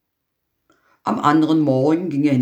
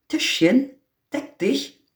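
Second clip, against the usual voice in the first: Tischchen, deck dich said much higher, rising; magic command; 10 semitones higher than usual. Acted. The apprentice commands his magic table, high rising call. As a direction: sing out the spell.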